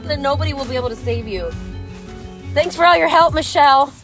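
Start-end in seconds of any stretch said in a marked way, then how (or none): none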